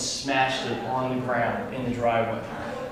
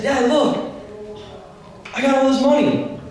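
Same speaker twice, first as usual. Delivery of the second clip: put-on much higher, eager kid showing off; excited boast; 11 semitones higher than usual. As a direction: bounce it up, proud of the cash. Showing dad his cash.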